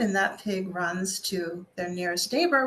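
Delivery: plain reading voice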